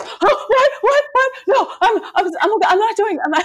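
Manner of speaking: put-on a kid caught and stammering excuses; startled kid voice. Mom imitating her kids caught on screens, high panicked stammer.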